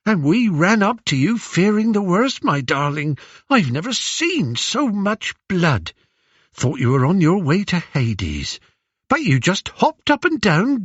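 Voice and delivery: high, animated, fondly remembering voice; doting old nurse. Acted. Old nurse reliving the hunt with Odysseus, doting. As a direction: fuss over your darling as you reminisce.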